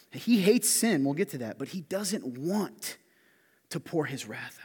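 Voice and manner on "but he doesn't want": "want" drawn out slow and high; stress on want. Shown as a demonstration. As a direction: stretch "want" slowly for weight. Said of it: "slow emphatic stress".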